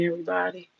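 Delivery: ordinary talk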